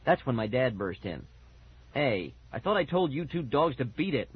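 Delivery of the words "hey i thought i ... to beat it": usual speaking voice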